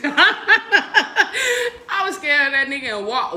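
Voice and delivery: slow, high words through laughter; real laughter at herself; laughing while talking. Real, not acted. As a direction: laugh out the confession. Laughing at own fear.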